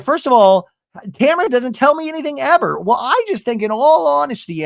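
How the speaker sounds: high, emphatic imitation of bickering; retold defensive argument. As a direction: act out the indignant comeback. Acted argument between friends.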